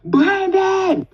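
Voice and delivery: high-pitched, drawn holler of a name; retold holler. Girl calling to her cousin across the field, hollering. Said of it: "and I'm hollering".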